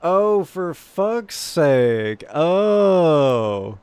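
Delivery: drawn-out groan. Real, not acted. Frustrated at slow loading.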